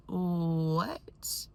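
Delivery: whispered, rising disbelief; stunned whisper. Retold or imitated. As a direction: whisper it as if dumbfounded. Acted shocked reaction, whispered.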